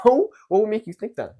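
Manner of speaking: mock surprised reply. Acting the other person's reply.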